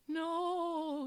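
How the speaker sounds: high, drawn-out plea; hammed-up wail of protest; pleading. A narrator's reading. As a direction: plead like a kid in trouble. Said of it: "he pleaded".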